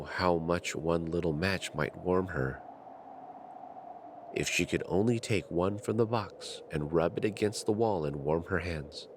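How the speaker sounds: ordinary delivery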